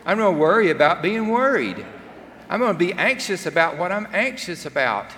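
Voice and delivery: put-on teen in church spiraling, loud and fretful; exasperated outburst. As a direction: throw it up in panicked frustration. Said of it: "And I thought".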